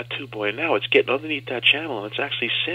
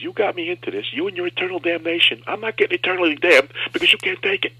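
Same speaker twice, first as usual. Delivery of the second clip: much higher, louder mock yell; doctor yelling; 8 semitones higher, 5 dB louder than usual. Surgeon yelling at patient to tough it out, hammed-up yell. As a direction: bark at the patient like an exasperated surgeon. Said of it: "he starts to yell at me".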